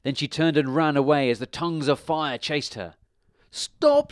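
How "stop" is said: very high, loud yelp; witch's cry. A narrator's reading. Witch caught by the flames, crying out high. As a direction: cry out for it to stop. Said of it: "she cried".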